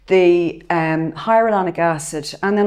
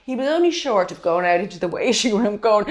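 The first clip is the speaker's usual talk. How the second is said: ordinary talk, nothing marked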